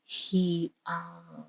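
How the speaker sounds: plain reading voice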